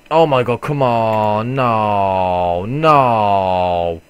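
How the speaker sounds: loud drawn-out despairing no; frustrated yelling. Real, not acted. Dying to a swarm of bugs, loud drawn-out wail. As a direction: wail as the character dies.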